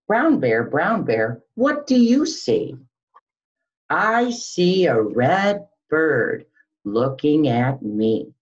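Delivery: put-on sing-song storytime voice for little kids; picture book read-aloud. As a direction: read it like circle time, lilting and slow. Reading picture book aloud to children.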